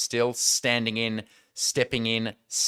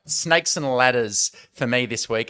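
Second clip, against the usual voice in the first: ordinary talk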